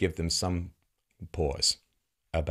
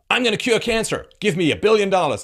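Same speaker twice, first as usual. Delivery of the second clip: mock pitch; 10 semitones higher, 8 dB louder than usual. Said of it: "I say".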